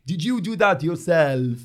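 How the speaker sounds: slow, hammy Count-like impression; Arthur as Dracula. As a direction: do a bad, drawn-out vampire voice. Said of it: "he sounds like he's a Dracula".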